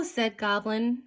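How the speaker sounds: plain reading voice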